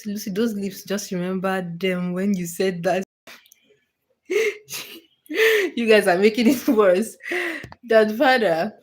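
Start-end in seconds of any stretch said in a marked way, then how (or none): 4.3-4.8 sudden high-pitched squealing laugh. Real, not acted. Streamer teased by chat, squealing laugh. Chat teasing about lips.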